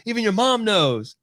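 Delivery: high, slow, smug sing-song; gloating taunt. Retold or imitated. Father taunting his seven-year-old son, smug and high. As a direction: rub it in with a high, drawn-out gloat. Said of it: "And I'm like".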